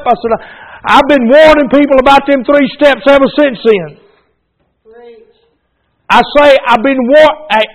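loud, high fervent preaching cadence; preacher pouring it on to the congregation, full-force preaching. Acted. Pastor testifying to the church, fervent full-force preaching.

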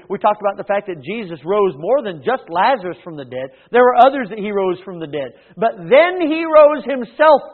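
ringing pulpit emphasis; forceful preaching. Real, not acted. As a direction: build to the climax.

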